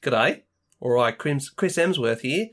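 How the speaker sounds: matey mock Australian accent; Chris Hemsworth. Playing the film's star inviting a friend surfing, mock Australian. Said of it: "That's kind of what he sounds like".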